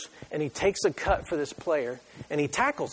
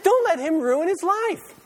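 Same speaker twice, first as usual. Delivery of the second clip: much higher, louder exasperated demand, slowing at the end; mock outrage at dad; 11 semitones higher, 5 dB louder than usual. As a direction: scold the father in a loud, high voice. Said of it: "I was thinking to Shane".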